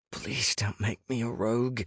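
plead, keeping it small; a small, anxious entreaty. A narrator's reading. Pleading in the narrator's crisis.

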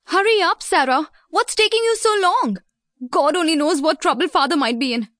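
high-pitched, urgent hurrying; impatient urging. Acted. Maddie calling back to Sarah as they run home, impatient. Brother urges lagging Sarah on.

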